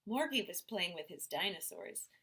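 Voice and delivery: plain reading voice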